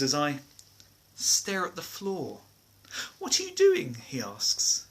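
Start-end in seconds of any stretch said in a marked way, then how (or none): 3.2-4.0 high-pitched question. Acted. Quoting Mister Juicy asking.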